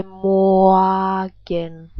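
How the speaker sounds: Morgen stretched out very slowly; slow-motion Morgen, R reduced to a vowel. Shown as a demonstration. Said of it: "if I would speak in slow motion".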